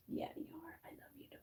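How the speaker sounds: soft whisper to the cat; whispered love to the cat. Real, not acted. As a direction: breathe it to the pet.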